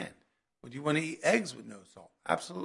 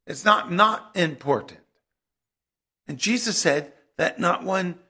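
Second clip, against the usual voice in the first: loud, ringing pulpit proclamation; full-force preaching; 5 semitones higher, 9 dB louder than usual. Acted. Preacher proclaiming Jesus' words on the law, loud and fervent. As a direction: preach it out to the back row.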